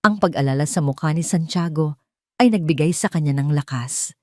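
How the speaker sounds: ordinary delivery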